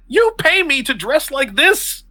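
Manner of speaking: indignant assistant. Acted. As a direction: throw it back at him, offended. Assistant complains about costume.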